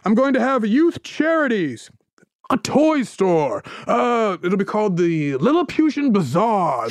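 hammed-up visionary huckster; grand con man. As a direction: present it like a ringmaster. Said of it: "And he's like".

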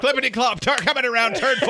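high, loud race caller; race announcer. Pretend. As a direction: call the horses round the turn. Calls a mock horse race.

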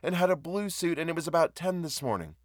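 high, urgent woman pressing her case; earnest, high-voiced appeal; pleading woman. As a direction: plead anxiously, pitched well up.

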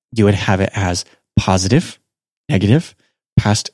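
usual speaking voice

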